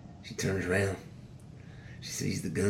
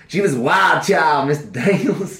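provoking shout; 7 semitones higher, 8 dB louder than usual. Acted. Prisoner provokes victim's father.

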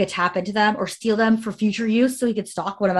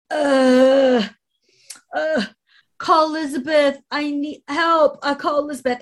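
high, groaning mock plea; pained dad imitation; 6 semitones higher, 3 dB louder, slower than usual.

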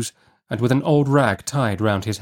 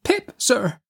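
put-on very high, timid child voice; frightened little boy; 13 semitones higher than usual. A narrator's reading. Pip answering the man who seized him, frightened. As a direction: answer as a scared small boy.

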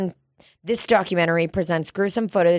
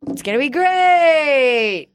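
slow, drawn-out and louder, each word stretched; excited shout; 6 semitones higher, 4 dB louder, much slower than usual. Real, not acted. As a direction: belt it out with pure excitement. Excited about alien documentary.

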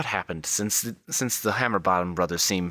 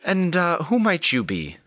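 Wilhelmina; 10 semitones higher than usual. Acted. Madam questioning an unknown newcomer, high formal voice. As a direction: lift the voice high for a formal inquiry. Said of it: "Wilhelmina says to you".

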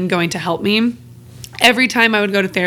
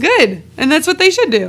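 high, loud, emphatic agreement; emphatic approval; 8 semitones higher, 4 dB louder than usual. Real, not acted. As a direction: cheer the idea with real conviction. Approves therapist just listening.

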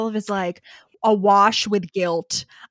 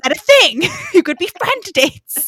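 excited laughing; 10 semitones higher, 9 dB louder than usual. Real, not acted.

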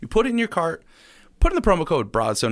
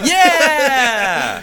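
excited yell; 12 semitones higher, 8 dB louder than usual. Real, not acted. Enthusiastic reaction to joke.

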